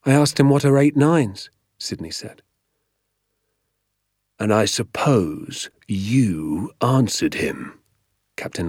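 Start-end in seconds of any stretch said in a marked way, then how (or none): 0.0-1.5 put-on slightly raised, earnest boy's voice; lightly voiced nervous pupil; schoolboy answering. 4.4-7.8 drawn-out, controlled interrogation; slow menace. Acted. Teacher's dangerous interrogation continues.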